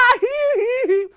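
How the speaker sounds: high loud wail; a shrieking burst of grief. Acted. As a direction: let out a loud falling wail. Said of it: "是甲目屎流目屎滴".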